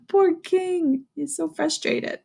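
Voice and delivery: ordinary delivery, nothing marked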